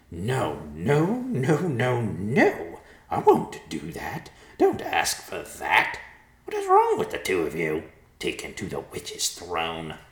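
slow, emphatic vulture outburst; protesting vulture. A narrator's reading. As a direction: refuse point-blank in a vulture character voice. Vulture refuses in horror.

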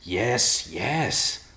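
gravelly, impatient agreement; exasperated gravelly stranger; frustrated gravelly voice. Acted. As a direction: brush it off gruffly. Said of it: "the voice said in frustration".

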